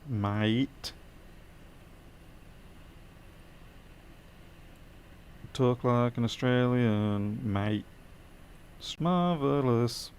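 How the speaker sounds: put-on Aussie accent, slow and drawn out; mock Australian drawl; bad Australian accent. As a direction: stretch it out in a hammed-up trashy Australian accent. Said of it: "talking trashy Australian vernacular and really bad accents".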